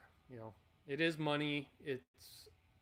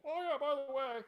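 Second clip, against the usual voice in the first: higher-pitched mock sudden remembering; mock afterthought; 10 semitones higher than usual. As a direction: pipe up as if just remembering. Imitates his own belated afterthought.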